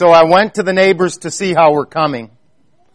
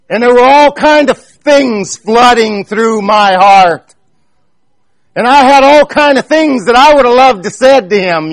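higher, louder earnest preaching; impassioned preaching; 5 semitones higher, 3 dB louder than usual. Acted. Preacher confessing his anger to the congregation, impassioned preaching. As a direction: preach it with mounting fervour.

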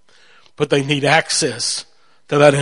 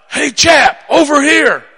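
loud, raised shout to get attention; booming call; 11 semitones higher, 5 dB louder than usual. Retold or imitated. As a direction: holler it like a big man calling someone over. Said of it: "it was a deep, booming voice".

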